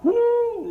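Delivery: a high, loud laughing whoop. Real, not acted. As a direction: whoop with delight. Laughing exclamation.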